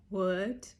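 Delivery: retold shock. Girlfriend facing a surprise proposal, mildly shocked. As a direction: react as if stunned, lightly acted.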